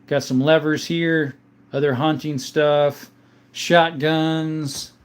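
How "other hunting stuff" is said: ordinary delivery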